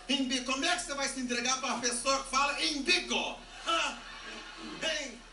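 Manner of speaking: hammed-up indignant questioning; appalled disbelief. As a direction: demand an answer, scandalised. Acted outrage at his mispronunciation.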